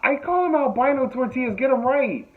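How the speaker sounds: high, loud retold protest; indignant protest. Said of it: "I said it just like that".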